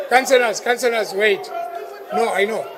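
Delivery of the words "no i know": ordinary talk, nothing marked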